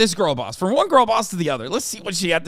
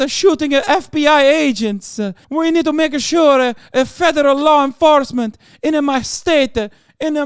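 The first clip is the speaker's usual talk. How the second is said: mock Italian politician; 8 semitones higher, 6 dB louder than usual. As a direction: fake Italian accent, much higher and louder, stammering uh. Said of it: "This was the guy who was like".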